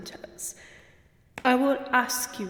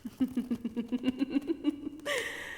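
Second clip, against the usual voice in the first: gloating laugh; 6 dB quieter than usual. Acted. Countess gloats over captive.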